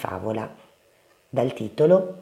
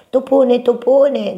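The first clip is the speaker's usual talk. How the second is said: high, loud call for help; grido di Topino; 10 semitones higher, 7 dB louder, slower than usual. A narrator's reading. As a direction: cry out like an alarmed little mouse. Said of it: "gridò Topino". (grido acuto di topolino)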